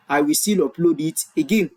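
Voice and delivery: usual speaking voice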